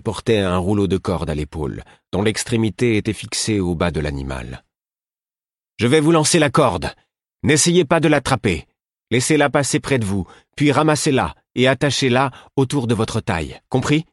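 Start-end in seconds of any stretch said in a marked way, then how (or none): 5.8-14.1 high, loud directions; calling out. Acted. Queen calling down to Fitz before throwing the rope. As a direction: shout the instructions down the slope. Ketriken shouts instructions down.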